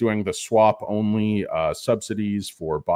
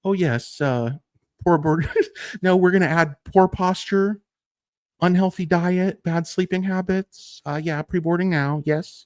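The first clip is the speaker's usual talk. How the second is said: higher, prim boarding call; snippy gate agent; 7 semitones higher than usual. Pretend. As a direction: needle the passenger politely. Mock flight attendant singling him out.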